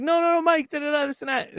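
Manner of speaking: high-pitched imitation of frantic intervening; urgent pleading. Club manager running down to calm Mike, urgent pleading. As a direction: pitch jumps up, imploring him to stop.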